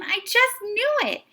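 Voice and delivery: high, loud, fast joy; bursting with relief; boy overjoyed to see his dog. A narrator's reading. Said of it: "said Tim".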